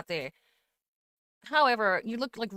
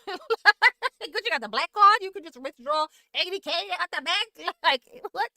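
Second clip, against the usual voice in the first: high laughing disbelief, louder at first; 7 semitones higher, 6 dB louder than usual. Real, not acted. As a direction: laugh it out, amazed anyone could withdraw that.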